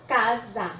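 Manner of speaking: "casa" in two separated beats, ca-sa; syllable by syllable. Shown as a demonstration. Teacher joins up the letters into syllables, saying "casa" as ca, then sa. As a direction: break "casa" between its syllables. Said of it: "eu vou ter a sílaba sa".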